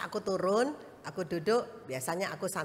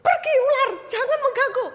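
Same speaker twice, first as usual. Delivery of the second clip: high, loud rebuke in Jesus' name; commanding the snake; 11 dB louder than usual. Retold or imitated. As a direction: order the snake away, loud. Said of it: "Saya langsung".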